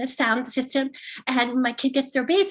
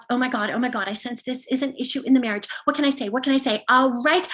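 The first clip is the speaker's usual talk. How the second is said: usual speaking voice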